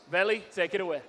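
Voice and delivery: ordinary talk, nothing marked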